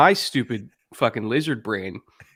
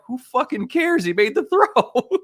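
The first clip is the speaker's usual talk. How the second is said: much higher, louder mock outburst; exasperated scoff; 13 semitones higher, 5 dB louder, slower than usual. Friend mocking him for obsessing over coverage, exasperated high yell. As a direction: toss it off like you can't believe he's still stuck.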